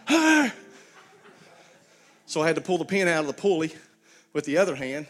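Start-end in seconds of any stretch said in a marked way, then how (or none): 0.1-0.5 retold yell. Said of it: "and he's like".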